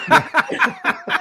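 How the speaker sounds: high burst of laughter; cracking up at the story; laughing. Real, not acted. Storyteller cracking up at his own fire story, laughing.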